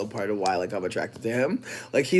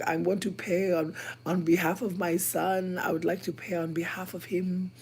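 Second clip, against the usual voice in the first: put-on British accent; 6 semitones higher than usual. Pretending to be Nat's mum on the phone, British accent. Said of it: "using a British accent".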